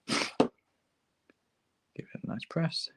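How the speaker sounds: normal talking voice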